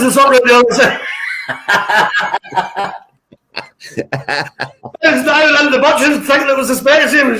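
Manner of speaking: real laughter through the words; laughing. Real, not acted. Telling a friend about mistaking the butcher's for Specsavers, laughing. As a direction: tell it while cracking up.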